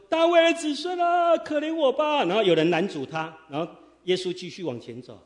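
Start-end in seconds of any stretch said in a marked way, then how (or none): 0.1-2.2 Bartimaeus crying out. Acted. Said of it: "巴底买当他喊说".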